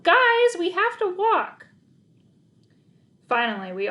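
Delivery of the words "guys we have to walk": very high, loud slow plea; desperate walker shouting; exasperated yell. Acted. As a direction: insist to the dogs. Exasperated at dogs.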